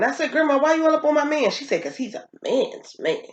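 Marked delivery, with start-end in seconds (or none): 0.3-1.5 pitched up and fast; mock indignant. Granddaughter retelling how she confronted grandma, fast and high. 1.8-3.2 imitating grandma. Voicing grandma in retold story.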